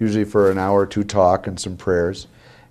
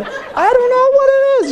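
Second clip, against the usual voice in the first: laughing; 4 dB louder than usual. Retold or imitated. Laughing at his own confusion.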